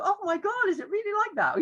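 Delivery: very high-pitched mock astonishment; teacher's playful amazement. Teacher reacts to mouse's squeaked tale, mock amazement. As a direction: react hugely, voice way up. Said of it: "And you go".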